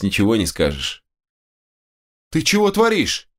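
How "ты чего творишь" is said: high, alarmed shout from behind; frightened cry. Acted. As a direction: call out in fright. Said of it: "донёсся до меня сзади испуганный голос Эдж".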